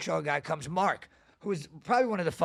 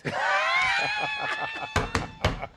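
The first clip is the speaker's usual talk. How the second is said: big laugh. Real, not acted. Host bursting out laughing at the joke. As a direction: crack up at the punchline.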